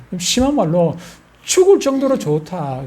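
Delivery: normal talking voice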